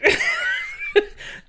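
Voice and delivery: shrieking high laugh; high squealing laugh. Real, not acted. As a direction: lose it completely. Cracking up at dildo chair.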